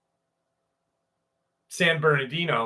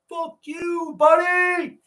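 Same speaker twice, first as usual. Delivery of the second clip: put-on much higher kid voice shouting; shrill bratty kid yelling back; mock kid shouting; slower than usual. Dad voicing the son cursing, high shout. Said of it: "be like".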